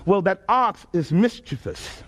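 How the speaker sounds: usual speaking voice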